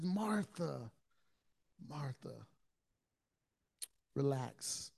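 quiet, gentle calming of a friend; tender. Retold or imitated. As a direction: soothe her softly, fading. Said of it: "he's tender with her, yet serious".

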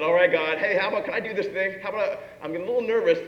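a nervous man bargaining with God, quick and anxious; nervous Gideon. Retold or imitated. Gideon nervously asking God for a sign, hurried and pleading. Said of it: "And he said".

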